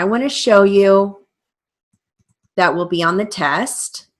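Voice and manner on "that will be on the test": ordinary talk, nothing marked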